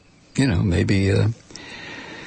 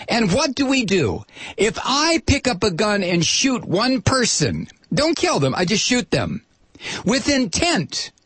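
high, emphatic outrage; real indignation, pitched up; indignant rant; 7 semitones higher than usual. Real, not acted. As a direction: build an angry case, punching each phrase. Ranting against the oil lobbyist.

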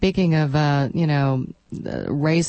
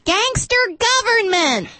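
very high, slow mocking squeal; screechy high voice; 4 dB louder, slower than usual. Shown as a demonstration. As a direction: demonstrate it as an exaggerated high screech. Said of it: "You have to say it like that".

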